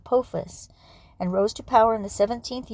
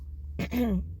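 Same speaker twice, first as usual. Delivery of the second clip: throat clearing; 2 semitones higher, 4 dB quieter than usual. Real, not acted. Throat clearing mid reading.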